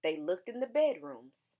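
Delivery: ordinary delivery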